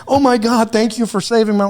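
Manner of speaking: high, gushing mock thanks; mock gratitude. Mocking the thanks the agent expected, high gushing. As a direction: ham up a grateful rescue.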